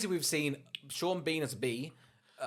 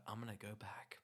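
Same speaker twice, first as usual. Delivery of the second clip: soft unvoiced mock vow; mock earnest whisper; 6 semitones lower, 12 dB quieter than usual. Heroine explaining her choice, soft mock whisper. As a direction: whisper it like a solemn promise.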